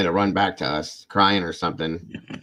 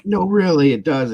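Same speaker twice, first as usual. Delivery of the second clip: put-on much higher, louder kid protest; insisting son; 10 semitones higher, 8 dB louder than usual. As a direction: push the fake sickness, pitched way up. Son insisting he's sick.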